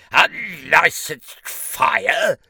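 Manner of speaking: can't believe what he heard; rising disbelief. Acted. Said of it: "looked at the diminutive figure in disbelief".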